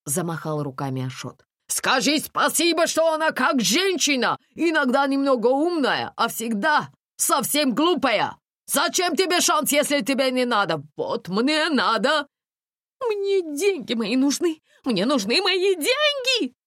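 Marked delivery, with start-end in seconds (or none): 1.7-12.3 animated scolding, pitched up; excitable, raised voice of an indignant man; exasperated driver Ashot. A narrator's reading. 13.0-16.5 high, slow hysterical demand; frantic drawn-out yelling; shouting. A narrator's reading. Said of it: "кричала Настя".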